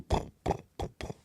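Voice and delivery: soft voiceless popping thuds; puffed little explosion noises; imitated distant bombing. Hearing bombs from inside the asteroid, soft imitated thuds. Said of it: "we can hear them in the distance go".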